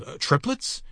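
hesitant, high rising question; hesitate, then squeak out the guess; appalled. A narrator's reading. Mister Button guesses at the news, appalled.

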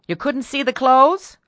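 high, loud, rising disbelief; incredulous question. A narrator's reading. Mistress questions her maid, loudly incredulous.

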